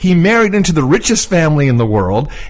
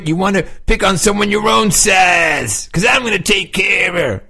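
mock shout; 4 semitones higher than usual. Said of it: "He said".

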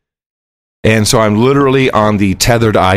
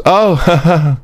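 dismissive; 5 semitones higher than usual. Retold or imitated. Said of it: "the guy literally goes".

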